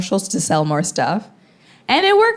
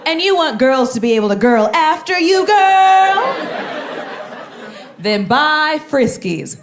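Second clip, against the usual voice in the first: put-on sugary, higher voice with a girly lilt; hammed-up empowerment commercial voice; mock ad pitch; 7 semitones higher than usual. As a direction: sell it like a syrupy ad aimed at women. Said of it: "they're essentially like".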